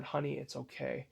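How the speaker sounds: calm her down with a near-hushed voice; soft reassurance. Retold or imitated. Father hugging his spooked wife, softly reassuring.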